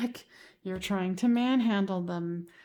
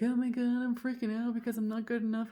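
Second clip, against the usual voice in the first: mock panic; 3 semitones higher than usual. Client freaking out, imitated.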